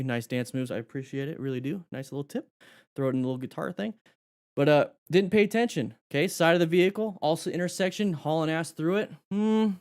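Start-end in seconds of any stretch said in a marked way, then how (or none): none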